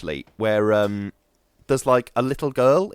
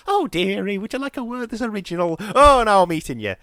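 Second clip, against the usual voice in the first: put-on high, sweet old-lady voice; a cosy granny who suddenly turns predatory; sugary grandma voice that ends louder; kindly old lady; 9 semitones higher, 4 dB louder than usual. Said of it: "the vampire is a kindly old lady that's like".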